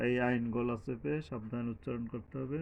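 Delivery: ordinary talk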